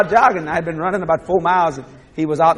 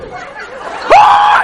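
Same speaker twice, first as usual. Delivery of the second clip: high, loud mock yelp; dog's panicked yelp; 7 dB louder than usual. The dog hearing bath spelled out, high mock yelp. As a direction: voice the dog bolting in fright.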